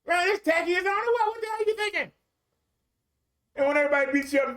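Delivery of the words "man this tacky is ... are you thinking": much higher mocking voice, blurting it out. Tactless bridesmaid mocking the dresses, high and brash. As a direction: squeal it high like a tactless guest. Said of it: "in front of the whole bridal party and say".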